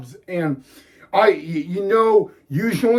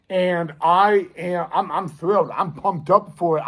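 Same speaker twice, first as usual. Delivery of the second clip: ordinary talk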